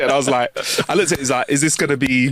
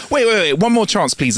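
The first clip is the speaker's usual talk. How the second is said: high, urgent mock begging; pleading; 7 semitones higher than usual. Dog's owner begs the bartender for one more chance, pleading. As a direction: beg quickly to be allowed to stay.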